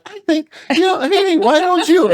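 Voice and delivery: hammed-up gentle nudge, voice way up; gentle wife. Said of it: "very gentle".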